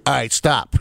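low mock rap-sample quote. Singer's colleague quoting a sample to make his point, lightly imitated rap line. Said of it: "didn't sample [name] saying".

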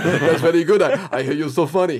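put-on booming praise in a lightly done Arnold accent; the host recalls Arnold praising him, loud voice; Arnold impression.

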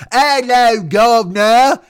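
loud, high, hammed-up British accent; overdone fake British, high and loud; bad British accent. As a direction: do a terrible improv-troupe British accent. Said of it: "the bad British accent of any improv troupe".

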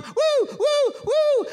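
very high falsetto whoops; excited whooping. Retold or imitated. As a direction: cheer with short high woos. Retold cheering alone in hotel.

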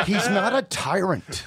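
high-pitched mock marvelling; mock amazement. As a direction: imitate an over-impressed admirer. Voicing people's easy praise of actors.

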